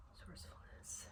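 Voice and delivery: breathy whisper to herself; a whispered reminder to herself. Real, not acted. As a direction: murmur the word under your breath. Rereading the pulled cards, whispered.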